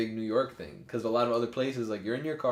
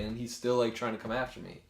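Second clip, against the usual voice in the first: ordinary delivery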